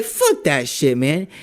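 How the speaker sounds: loud, cursing snap; mock fury at a scammer; retold angry retort. Brushing off the scammer's pitch, loudly cursing.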